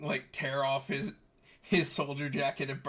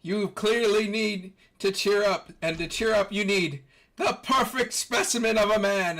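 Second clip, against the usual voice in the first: hammed-up muscleman boast; showboating strongman; 6 semitones higher, 5 dB louder than usual. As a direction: proclaim it grandly while showing off. Armstrong cheers them up, flexing.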